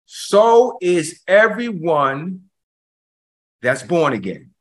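verse spaced out very slowly, dropping on "born again"; slow right down, pause before "that's born again"; deliberate, drawn-out preaching for emphasis; slow emphatic repeat. Shown as a demonstration. Slow repeat after calling listen thrice.

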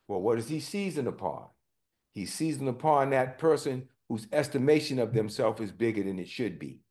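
ordinary talk

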